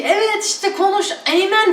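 mock congregation cheer. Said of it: "falan diyor işte".

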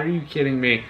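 ordinary delivery

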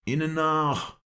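loud, high battle yell; urgent high bellow; Ahmed Al-Gandur's roar. A narrator's reading. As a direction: shout the order to give chase. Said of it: "brüllte Ahmed Al-Gandur".

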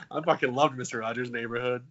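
normal talking voice

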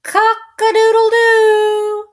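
very high, loud crow; rooster crow. Acted. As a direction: crow it out to end the book.

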